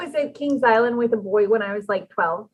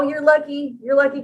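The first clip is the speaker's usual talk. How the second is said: ordinary talk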